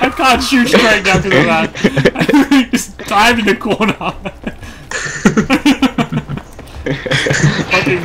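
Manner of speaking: laughing. Real, not acted. Laughing while gaming.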